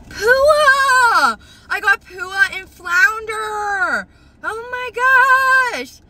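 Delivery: high, stretched squeals of joy; excited screaming. Real, not acted. Collector remembers the pig's name, excited screaming. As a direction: scream the names in delight. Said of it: "I'm screaming 'cause I'm so fucking excited".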